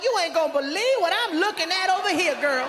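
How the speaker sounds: put-on high, fast girlfriend gossip voice, laughing; excited gossip on phone. Comedian voicing a woman calling her friend, excited gossip. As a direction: spill the tea to Kiki, breathless.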